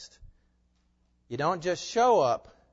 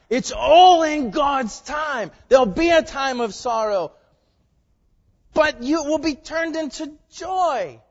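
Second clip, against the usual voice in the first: loud, high-pitched sermon delivery; full-force preaching; 10 semitones higher, 11 dB louder, slower than usual. Real, not acted. Pastor proclaiming coming joy to his congregation, full-force preaching. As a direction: preach it out to the back pews.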